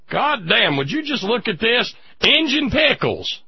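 high, slow Appalachian hillbilly drawl; hillbilly accent. Retold or imitated. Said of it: "with the hillbilly accent".

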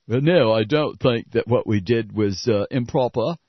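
smarmy AIG head in a mock British accent. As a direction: imitate a smug executive in a British accent. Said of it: "his British accent".

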